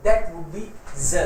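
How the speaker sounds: z drawn out very slowly; slow rule statement. Shown as a demonstration. Teacher stating the s-to-z rule to the class, very slowly. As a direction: spell out that would be z slowly. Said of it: "rule ये है कि".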